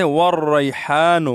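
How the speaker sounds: والریحان as "war-rayhaanu", heavy r and throaty h; correct articulation. Shown as a demonstration. Teacher presenting the next word, careful recitation. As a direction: hold the "ray" of والریحان, then a throaty haa. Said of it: "اس کے بعد ہے".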